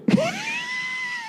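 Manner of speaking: very high squealing burst of laughter; real helpless shriek of a laugh; cracking up in a high squeal. Real, not acted. Laughing at retold insult.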